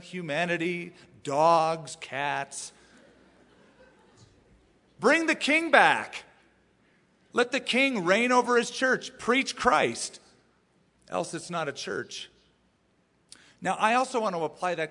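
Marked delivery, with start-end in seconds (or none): 5.0-10.2 high, loud preacher's cry; rallying exhortation. Real, not acted. Preacher urging churches to bring the King back, rallying cry. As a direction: proclaim it forcefully, high and loud.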